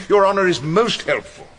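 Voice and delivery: mock courtesy to an interfering judge; sarcastic. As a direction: thank him with sarcastic sweetness.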